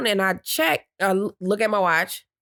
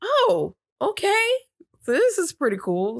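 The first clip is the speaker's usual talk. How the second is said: higher, easygoing and light; breezy reaction, higher; high casual reply; 11 semitones higher than usual. Retold or imitated. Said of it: "I'm like".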